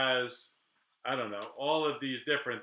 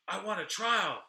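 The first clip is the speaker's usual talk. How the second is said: stubborn client; 7 semitones higher than usual. Retold or imitated.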